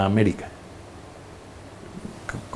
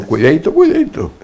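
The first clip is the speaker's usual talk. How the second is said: second cuidadito leaps much higher; mock warning; 6 semitones higher, 4 dB louder than usual. The wife warning her fiancé, teasing sing-song high.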